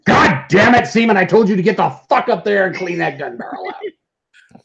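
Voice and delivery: military command voice. Shown as a demonstration. Showing off his Navy command voice for the hosts, barking a cursing order. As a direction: bawl out a sailor in a command voice. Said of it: "get me into my military voice".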